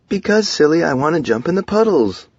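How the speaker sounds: high, singled-out female voice, playfully teasing; playful wife. A narrator's reading. Wife explaining to her husband about puddles, high playful voice. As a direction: tease him in a high, excited voice.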